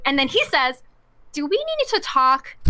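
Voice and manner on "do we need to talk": slow, high, pointed question; imitating boss. Boss corners employee in empty hallway, pointed. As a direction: draw out the boss's loaded question. Retold boss confronting the avoider.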